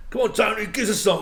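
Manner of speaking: loud high cheeky pub shout; egging on. Retold or imitated. Mates in the pub urging Tony to sing, loud coaxing shout. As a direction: chant at him to get up and sing.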